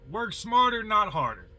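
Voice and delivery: ordinary talk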